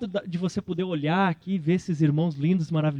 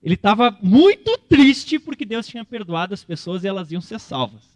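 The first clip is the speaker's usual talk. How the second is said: usual speaking voice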